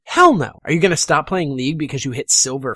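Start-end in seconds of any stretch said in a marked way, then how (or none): 0.0-0.6 much higher and louder, a fired-up no; emphatic shout. Shown as a demonstration. Coach firing up viewers, loud emphatic shout. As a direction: slam the answer down hard. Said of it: "are you gonna run away".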